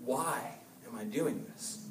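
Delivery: soft, low, inward question to yourself; quiet self-question. Shown as a demonstration. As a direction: ask it quietly like a private thought. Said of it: "sit down and think".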